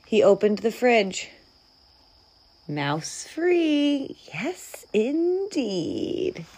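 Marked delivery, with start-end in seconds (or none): 2.7-6.4 higher, savoring it with pauses; self-satisfied, leisurely confirmation; satisfied relief. A narrator's reading. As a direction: say it with pleased relief. Bear pleased to find no mouse.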